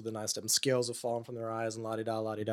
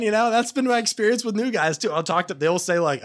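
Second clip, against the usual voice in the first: ordinary delivery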